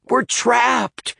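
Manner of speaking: high panicked yell. A narrator's reading. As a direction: cry out in panic. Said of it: "yelled Dan".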